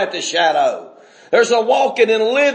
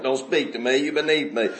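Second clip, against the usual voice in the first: low, soft mock snobbery; a hint of haughty disdain, hushed; snooty sourpuss, quieter; 7 semitones lower, 6 dB quieter than usual. Preacher repeating the snob's words, quieter mock disdain. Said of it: "Bunch of sourpusses".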